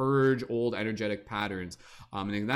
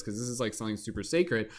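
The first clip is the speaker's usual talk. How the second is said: usual speaking voice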